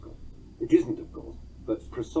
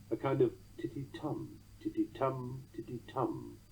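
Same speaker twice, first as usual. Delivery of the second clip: "titty-tum" with two light syllables, then a strong TUM; soft rhythmic "titty-TUM", repeated as a triple beat; stress on third beat; 9 dB quieter than usual. Shown as a demonstration. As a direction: chant "titty-tum" leaning on each "tum". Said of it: "the third one bearing the beat".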